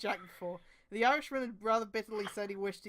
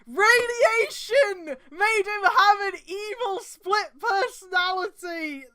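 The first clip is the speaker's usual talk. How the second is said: slow, much higher and louder, hammed-up melodrama; mock dramatic outburst; 9 semitones higher, 10 dB louder, slower than usual. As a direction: wail the reveal like a soap opera. Reading a fanfic with mock drama.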